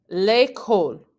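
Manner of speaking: "L'école" run together as one word, lay-kohl; words squished together. Shown as a demonstration. French teacher giving an example, "L'école" squished into one word. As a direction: say "L'école" firmly, the L joined onto the vowel. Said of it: "those two words are squished together".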